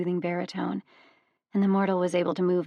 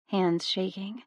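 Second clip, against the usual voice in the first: usual speaking voice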